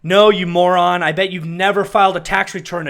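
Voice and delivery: furious retort at the anchor; angry retort. Real, not acted. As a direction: cut the reporter down hard. Yelling at the news clip.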